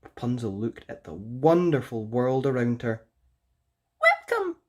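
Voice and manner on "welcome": very high, prim bird voice; Sylvia the bird. Acted. Haughty bird correcting Rapunzel, very high voice. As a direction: squeak it out like a haughty little bird. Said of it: "said Sylvia".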